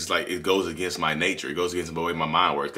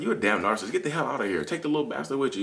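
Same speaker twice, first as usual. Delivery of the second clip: retold yelling; 8 semitones higher than usual. Husband snapping back at wife, mock yelling in high pitch. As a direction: act out a mad comeback, pitched up. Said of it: "I'm yelling and screaming".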